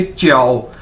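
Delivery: "च्याओ" with J said as a "ch" sound; model pronunciation. Shown as a demonstration. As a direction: demonstrate "च्याओ" as one falling, run-together word. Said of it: "इसको कैसे बोलेंगे".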